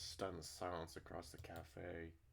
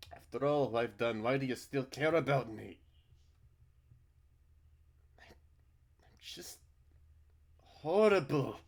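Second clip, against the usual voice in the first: self-loathing; 7 semitones higher, 15 dB louder than usual. Acted. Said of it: "guilt and self-loathing".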